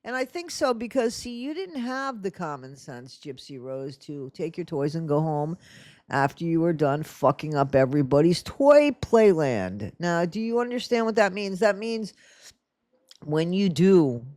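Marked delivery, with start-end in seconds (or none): none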